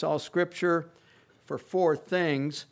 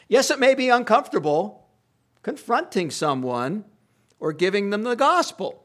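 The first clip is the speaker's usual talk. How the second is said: emphatic preaching; 5 semitones higher, 6 dB louder than usual. Real, not acted. Preacher conceding discomfort.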